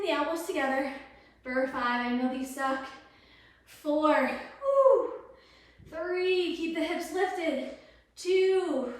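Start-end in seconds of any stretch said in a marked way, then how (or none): 4.3-5.1 high, loud whoop; quick whoop of encouragement; excited woo. Real, not acted. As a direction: cheer with a sudden high woo. Whoop while counting.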